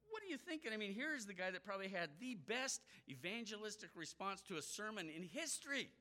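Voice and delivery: ordinary talk, nothing marked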